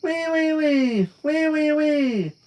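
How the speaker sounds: high pig-like squeals. Acted. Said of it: "she really did sound like a pig".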